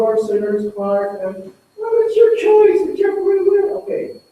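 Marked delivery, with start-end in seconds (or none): 1.8-4.2 mocking shrug. Mocked passive Christ at the door.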